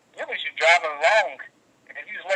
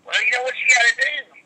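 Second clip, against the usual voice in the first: lecturing like a gym coach; mock know-it-all; 8 semitones higher than usual. Dude giving advice backstage, mock preachy. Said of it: "And he goes".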